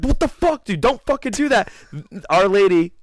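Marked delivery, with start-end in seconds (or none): 0.0-1.7 high, incredulous what the fuck; mock outrage. Friends scolding the kid, high mock outrage. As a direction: pitch up in mock anger at the kid. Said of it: "We were like".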